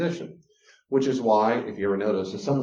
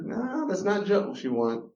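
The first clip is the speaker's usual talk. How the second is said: usual speaking voice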